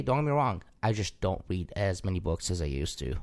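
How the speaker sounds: ordinary talk, nothing marked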